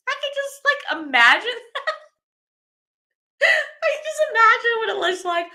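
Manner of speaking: high giggling through words; laughing. Real, not acted. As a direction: crack up while telling it. Giggling at naked grape stompers.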